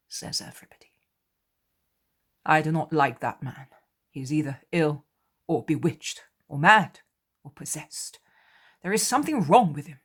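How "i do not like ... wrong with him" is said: resolute. Acted. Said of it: "says Thaddeus resolutely".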